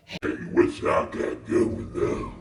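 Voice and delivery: very low, slow, croaky man's voice; creepy stranger. Retold or imitated. Strange man approaching three women outside, low slow imitation. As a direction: drop way down into a slow mock man's voice. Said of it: "but he's like".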